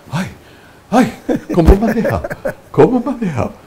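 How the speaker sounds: high voice broken with laughter; laughing off the fright, still shaken. Retold or imitated. The startled priest to his old friend, laughing and high.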